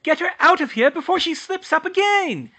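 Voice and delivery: high, loud scornful tirade; spiteful rant. Acted. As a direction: spit insults at the girl. Muriel ranting at Hermione.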